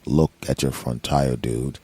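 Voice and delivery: low, slow, spooky dude voice; deep, dragged-out warning from nowhere; mysterious stranger. Retold or imitated. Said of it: "dude walking around the supermarket going".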